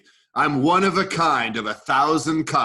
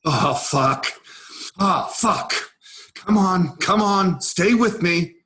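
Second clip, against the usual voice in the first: panicked, desperate; 5 semitones higher than usual. Acted. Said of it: "He is panicked and desperate".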